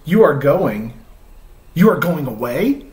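reading a picture book aloud, voicing Gerald; reading to children. Dad reads Mo Willems aloud to viewers with Chloe, storytime voice.

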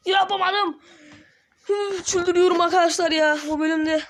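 frustrated outburst. Real, not acted. Frustrated at failing task.

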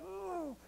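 high startled ooh; a sharp high ooh of conviction; retold ooh. Preacher recalling the Spirit's tug, high ooh. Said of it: "I'd be like".

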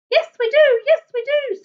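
high, squawky little bird chorus; squawking birds. A narrator's reading. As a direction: pipe it up high like excited birds. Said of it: "squawked the rainbow birds".

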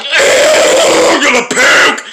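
drawn-out mock "ew"; exaggerated retold queasy groan; retold disgust. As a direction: act out being grossed out. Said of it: "then I was like".

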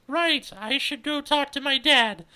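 high, loud, slow excuse; drawn-out, high-voiced excuse; awkward exit. Cordelia makes awkward exit.

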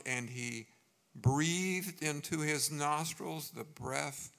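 preacher's full-voiced Bible reading; preaching scripture. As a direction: proclaim the verse to the congregation. Pastor reads Genesis forcefully.

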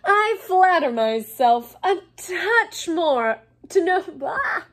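high, loud, slow smugness; pompous, pleased with himself; self-satisfied. A narrator's reading. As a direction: preen with theatrical self-importance. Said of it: "in a self-satisfied tone".

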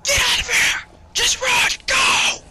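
movie victim screaming at friends to flee; very high, loud, urgent yell; hammed-up self-sacrifice scream; mock heroic shout. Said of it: "those people in the movies that go like".

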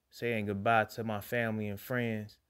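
normal talking voice

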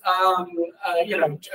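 normal talking voice

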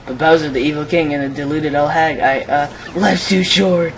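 high-pitched panicky game character; alarmed ticket seller. Acted. Ticket seller balks at evil king and old hag, rising alarm. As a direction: rise to a high, loud refusal.

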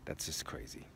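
hushed, breathy aside under the breath; whispered aside. Real, not acted. To viewers about a pricey gold pendant, whispered.

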